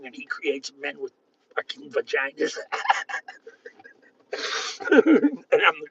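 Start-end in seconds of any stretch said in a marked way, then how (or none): 2.6-3.2 soft chuckle. Real, not acted. Man amused by what the voices tell him, soft chuckle. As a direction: chuckle quietly at your own remark. Laughs at own remark.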